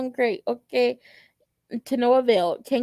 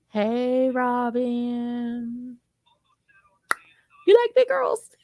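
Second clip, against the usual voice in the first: flirty tease; much slower than usual. Pretend. Vlogger flirting with character.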